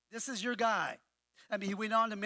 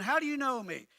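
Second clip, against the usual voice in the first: higher and louder, pushing back hard; challenging; 5 semitones higher, 4 dB louder than usual. Shown as a demonstration. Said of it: "He's going".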